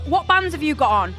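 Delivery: normal talking voice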